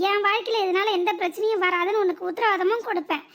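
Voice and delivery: usual speaking voice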